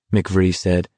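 usual speaking voice

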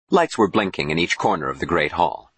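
ordinary delivery

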